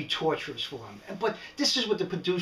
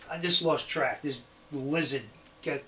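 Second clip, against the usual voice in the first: ordinary talk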